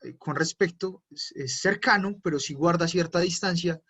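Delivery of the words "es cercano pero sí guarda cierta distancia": ordinary delivery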